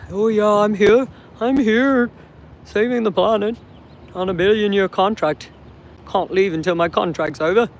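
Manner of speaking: put-on English accent, mocking a guard; hammed-up English-accented impression. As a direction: mimic him in a silly English accent. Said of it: "I don't know why I have an English accent doing that".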